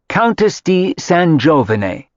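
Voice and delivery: slow, grand Italian pronunciation of Countess di San Giovane; haughty mother corrects the roommates in Italian accent. A narrator's reading. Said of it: "in the accent her late husband had taught her".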